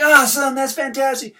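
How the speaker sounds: high, loud, hyped-up praise; hype. Retold or imitated. Someone hyping a friend's goal, high and loud with excitement. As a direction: gush with over-the-top energy. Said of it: "All this hype, all this energy".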